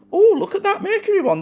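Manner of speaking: high, excited exclamation; suddenly delighted, pitch jumps up. Real, not acted. Bulb suddenly relights.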